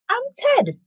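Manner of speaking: high squeaky little character voice; Ted's voice. Acted. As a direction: pitch it up high for the little thief. Ted introduces himself in story.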